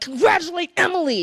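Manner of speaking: high, loud mock-yelled order; mock shout. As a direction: bellow it like an overexcited cheerleader. Screaming emojis at husband.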